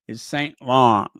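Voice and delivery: It's Saint Laurent in the French way, no final t; French pronunciation. Shown as a demonstration. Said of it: "I gotta say it like a French".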